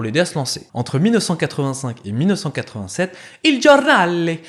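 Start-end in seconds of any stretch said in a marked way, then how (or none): 3.4-4.4 loud, high, drawn-out hammed-up Italian; caricature Italian accent. Narrator announcing the café's name, hammed-up Italian accent. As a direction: put on a mock Italian accent. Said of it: "faire cet accent italophobe".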